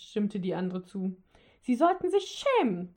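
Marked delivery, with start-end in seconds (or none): 1.6-2.9 high, loud scolding rebuke; rebuking. A narrator's reading. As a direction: wag a finger with your voice, high and loud. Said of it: "tadelte die Dicke den Weihnachtsmann". (hohes, lautes Tadeln)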